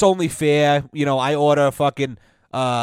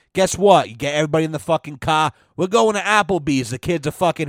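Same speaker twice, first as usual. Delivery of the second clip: ordinary talk, nothing marked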